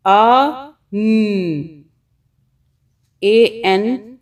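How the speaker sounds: "अ" then "न", loud and separate; sounding out. Shown as a demonstration. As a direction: split "अन" into its sounds. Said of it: "अन". ("अ" "न" अलग-अलग)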